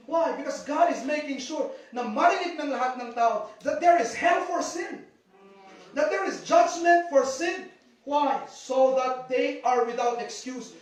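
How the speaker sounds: preacher pressing the point home; rising emphatic preaching. Real, not acted. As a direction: build the warning to a peak. Preacher building to emphatic peak.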